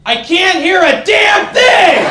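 put-on high, slow, shouted old lady; old woman shouting in the back; deaf old woman. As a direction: yell it like a deaf granny. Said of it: "one old woman in the back go".

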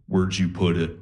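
low, creepy sleep-talker; Buffalo Bill. Acted. Girlfriend turns to him in bed, low creepy voice. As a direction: imitate a sinister voice from deep sleep. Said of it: "Why do you sound like Buffalo Bill?".

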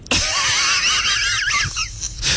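long high laugh. Real, not acted. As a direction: cackle of laughter. Host laughs at taffy joke.

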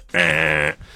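low, harsh vocal buzzer; wrong-answer buzzer. Acted. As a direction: imitate a game-show buzzer for a wrong answer.

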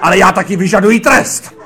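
high, loud proclamation; hammed-up director ranting at the policeman; self-accusing tirade. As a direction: confess at a bellow and demand punishment. Director confesses, demands punishment loudly.

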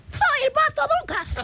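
very high, lisping cartoon duck; Daffy Duck. Shown as a demonstration. Host showing off her duck impression, very high and lisping. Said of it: "también me sale la del Pato Lucas".

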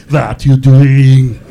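put-on Arnold asking a question in a heavy Austrian accent; Schwarzenegger impression. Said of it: "That voice he uses when he asks a question".

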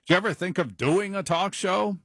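a hint of a curious radio executive; imitating radio executive. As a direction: toss it off like a casual boss. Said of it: "made the mistake of saying".

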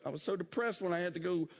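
ordinary talk, nothing marked

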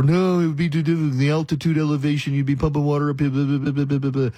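mock protest dissolving into babble; mocking naysayers. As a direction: imitate whiny objectors blathering on. Mocking naysayers, trailing into blah blah.

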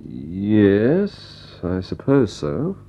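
drawn-out, hesitant agreement; a doubtful, lingering yes; unsure. Acted. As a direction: agree slowly, with doubt left hanging. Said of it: "You don't sound so sure".

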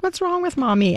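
high, mock-childish whine of a kid; kids' voice. Mother imitating her kids laughing at her, high childlike voice. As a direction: squeak it like a puzzled little kid. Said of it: "They were like".

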